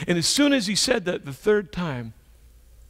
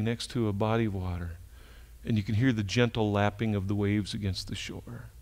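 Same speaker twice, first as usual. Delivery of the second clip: guided imagery; 7 semitones lower, 4 dB quieter than usual. Acted.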